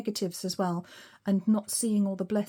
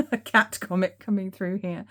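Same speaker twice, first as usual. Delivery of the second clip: laughing; 4 dB louder than usual. Real, not acted. Laughing about the cat comet.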